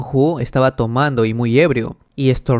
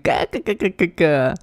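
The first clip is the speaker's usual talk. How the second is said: high, softer cackling laugh; 5 semitones higher, 3 dB quieter, slower than usual. Acted. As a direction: cackle with glee. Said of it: "se comenzaba a reír".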